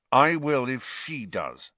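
forced through clenched teeth; words squeezed through gritted teeth. A narrator's reading. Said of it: "through gritted teeth".

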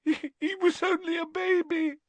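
very high, stammering wail; sobbing. A narrator's reading. Lavender mourning her baby rabbit, sobbing. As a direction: choke it out tearfully. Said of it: "sobbed Lavender".

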